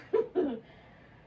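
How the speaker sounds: quick amused laugh; short laugh. Real, not acted. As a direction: laugh briefly. Laughs after floor remark.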